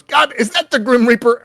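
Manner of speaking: high, loud gruff cry. Acted. Gruff stranger before the communication cuts out, high gruff holler. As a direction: yell it in a worked-up gruff voice. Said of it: "he goes".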